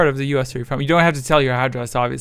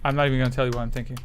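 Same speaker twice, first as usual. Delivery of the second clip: ordinary talk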